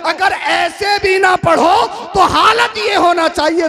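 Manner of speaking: full-force preaching. Acted. Preacher urging the congregation about prayer, shouting at full force. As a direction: thunder it out to the crowd like a fiery preacher.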